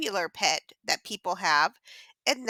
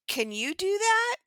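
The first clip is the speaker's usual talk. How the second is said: ordinary talk, nothing marked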